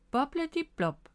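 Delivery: high, playful incantation; playful magic spell. A narrator's reading. Bob casts bubbles to cheer Miffy, playful spell. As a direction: pronounce the silly spell brightly.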